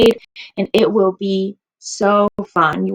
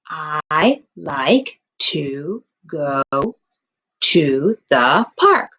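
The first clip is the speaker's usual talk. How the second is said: slow sentence read; 5 semitones lower, much slower than usual. Shown as a demonstration. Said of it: "I know what my sentence looks like".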